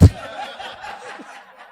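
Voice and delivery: breathy burst of laughter; delighted laugh. Real, not acted. Preacher laughs after acting the crowd, breathy. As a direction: laugh with joy at the victory.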